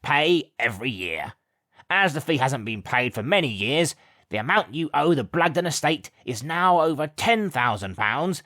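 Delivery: a hint of an officious servant reading; lightly voiced official notice; formal servant. A narrator's reading. Gawk reads the demand to Mum, officious.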